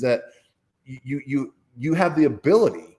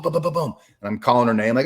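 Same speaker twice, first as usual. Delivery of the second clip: ordinary delivery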